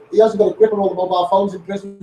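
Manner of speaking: ordinary talk, nothing marked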